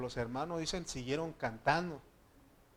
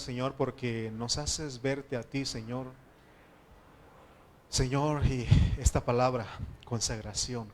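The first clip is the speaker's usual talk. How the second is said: loud, pleading prayer to the Lord; fervent prayer; 6 dB louder than usual. Acted. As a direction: pray out loud with urgency. Pastor leads closing prayer.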